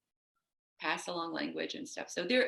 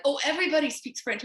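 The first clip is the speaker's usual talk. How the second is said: high, brisk mock-parent voice, louder; parents insisting; 9 semitones higher, 6 dB louder, faster than usual. Parents brushing off their daughter in a light mock voice. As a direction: toss it off like an airy parent. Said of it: "They're like".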